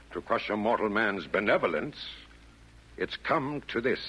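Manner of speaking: ordinary talk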